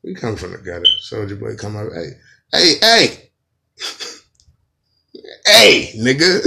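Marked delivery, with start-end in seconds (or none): 1.9-6.4 loud hammed-up calling out; mock shout. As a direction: yell across the room. [name] calling out loudly.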